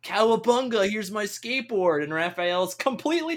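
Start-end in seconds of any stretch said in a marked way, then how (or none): 0.0-2.0 laid-back California surfer dude; California surfer accent. Retold or imitated. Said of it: "a California accent".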